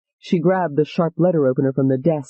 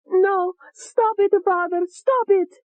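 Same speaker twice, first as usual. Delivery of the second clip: much higher, slow, terrified pleading; a girl crying out to her father, higher and slow; screaming; slower than usual. Acted. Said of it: "she was screaming".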